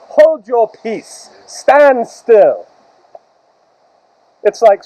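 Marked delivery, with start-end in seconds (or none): 0.1-2.7 King James phrasing. Acted. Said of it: "it's just King James".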